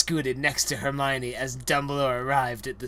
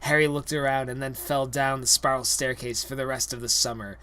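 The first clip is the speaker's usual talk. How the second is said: usual speaking voice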